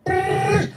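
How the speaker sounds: high buzzing phone ring noise; phone buzz imitation.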